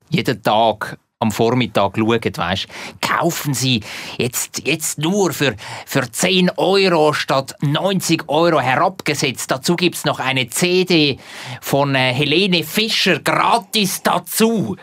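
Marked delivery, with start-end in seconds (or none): 3.0-14.8 slow, hammed-up TV shopping pitch; chipper 1950s ad. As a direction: sell it like a teleshopping host. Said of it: "wo auch in dem Verkaufs-T V".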